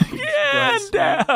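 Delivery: high, laughing dramatic shout; laughing movie shout. Retold or imitated. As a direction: laugh through a hammy command. Repeating film line laughing.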